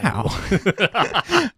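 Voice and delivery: high, drawn-out actor's catchphrase; hammed-up American movie-star impression; Owen Wilson. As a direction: say it high and airy in mock amazement. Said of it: "You make him sound like Owen Wilson".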